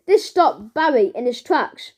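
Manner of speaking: ordinary delivery, nothing marked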